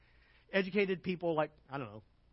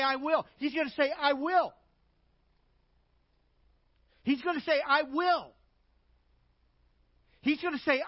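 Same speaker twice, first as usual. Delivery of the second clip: loud, higher preaching push on the Lord; emphatic preaching; 6 semitones higher, 4 dB louder than usual. Acted. Preacher to church, hammering I am the Lord loudly. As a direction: proclaim it to the congregation. Repeating I will forcefully.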